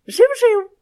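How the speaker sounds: very high, loud happy cheep-cheep; joyful squawk. A narrator's reading. Parrot happily answers the angry sparrows. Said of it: "відповів їм радісно папуга".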